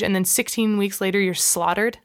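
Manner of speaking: normal talking voice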